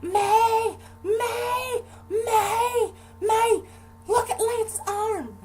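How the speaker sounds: very high, loud panicked shrieks; screaming mom. Retold or imitated. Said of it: "She's screaming at my aunt".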